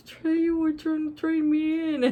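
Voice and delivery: lightly voiced hurt dog; voicing Snoopy. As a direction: play the betrayed dog, half laughing. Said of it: "He's like".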